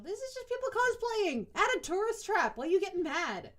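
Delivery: loud, high-pitched exasperation; exasperated outburst. Real, not acted. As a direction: protest loudly, pitch shooting up. Exasperated rant at book's complainer.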